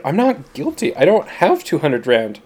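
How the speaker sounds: high-pitched, indignant sputter; sputtering protest. A narrator's reading. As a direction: blurt out a panicked denial, pitch jumping up. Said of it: "Sam sputtered".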